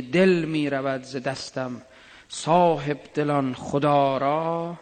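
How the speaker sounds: drawn-out recitation of Hafez, slow; slow verse recital. Acted. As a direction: linger over every word of the couplet.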